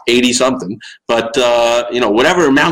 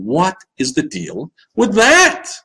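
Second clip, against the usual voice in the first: slow, high Long Island "What is the deal"; mock Jerry Seinfeld standup delivery; exaggerated Seinfeld; 6 semitones higher, slower than usual. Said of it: "I exaggerate it by going".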